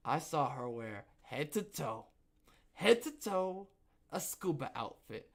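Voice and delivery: normal talking voice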